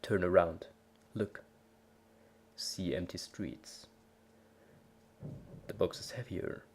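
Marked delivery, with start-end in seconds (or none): none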